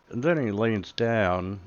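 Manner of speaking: ordinary talk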